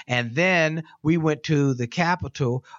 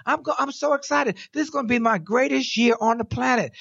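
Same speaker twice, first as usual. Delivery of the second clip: thrilled cookie man; 12 semitones higher than usual. Retold or imitated. Wally voiced, thrilled, selling cookies.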